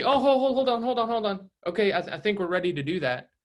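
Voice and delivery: pleading buyers catching the agent before he leaves; urgent stop. Retold or imitated. Buyers stopping the agent walking away, urgent and high.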